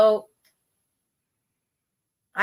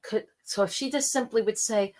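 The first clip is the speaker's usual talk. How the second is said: ordinary delivery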